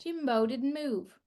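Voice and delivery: ordinary talk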